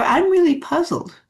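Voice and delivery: high, wondering "I'm really puzzled". Shown as a demonstration. Answering a bully at work, puzzled and high. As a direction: sound honestly baffled, not accusing. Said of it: "Puzzled tone of voice".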